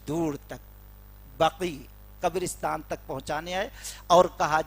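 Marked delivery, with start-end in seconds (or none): none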